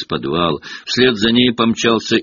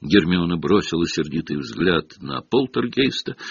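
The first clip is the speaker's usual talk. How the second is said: plain reading voice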